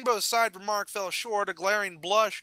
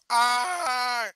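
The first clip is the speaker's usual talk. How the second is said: long, high pained yell; loud agonised shriek cutting off the curse; scream of pain; 6 semitones higher, 5 dB louder than usual. Acted. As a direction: cry out as the pain hits. Broken leg touches ground.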